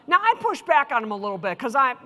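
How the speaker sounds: usual speaking voice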